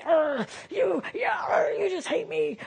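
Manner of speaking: a high, shouted snarl; mock demon growl. Preacher mimicking the defender spirit, high shouted growl. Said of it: "This is the".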